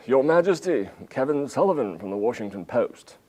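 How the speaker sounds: loud formal announcement; mock announcing handler. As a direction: announce him grandly to the Queen.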